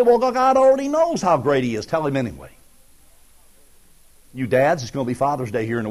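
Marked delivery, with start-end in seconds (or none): 0.0-1.9 higher, dismissive excuse; mock lazy churchgoer's excuse. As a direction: wave the idea away.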